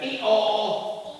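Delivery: high, drawn-out "ee-aw"; playful spoken hee-haw; donkey bray. Acted. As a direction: bray before speaking as the donkey. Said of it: "So the donkey said".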